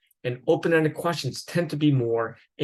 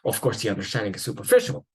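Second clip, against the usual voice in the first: ordinary talk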